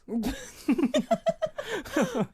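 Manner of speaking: sudden high-pitched laugh; high burst of laughter. Real, not acted. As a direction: break into a high giggle. Laughs about the hyungs.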